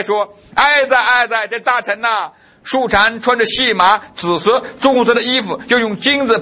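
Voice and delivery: scripture reading. Acted. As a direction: proclaim the verses to the hall.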